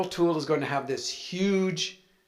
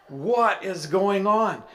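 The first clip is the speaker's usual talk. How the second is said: bewildered; 6 semitones higher, 4 dB louder, slower than usual. Retold or imitated. Shock at room of jesters.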